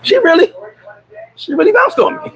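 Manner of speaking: high unvoiced shocked exclamation; stunned disbelief. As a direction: gasp it out in disbelief. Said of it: "I was like".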